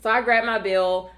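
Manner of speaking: normal talking voice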